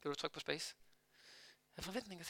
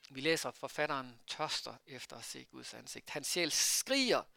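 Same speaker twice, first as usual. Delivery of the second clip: normal talking voice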